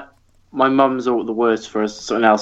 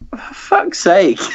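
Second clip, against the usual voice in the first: exasperated curse; 9 semitones higher, slower than usual. Retold or imitated. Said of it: "It's like".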